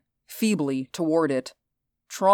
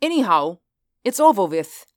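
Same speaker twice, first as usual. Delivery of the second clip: dismissive; 4 semitones higher, 4 dB louder than usual. Acted. Reinhardt dismissive.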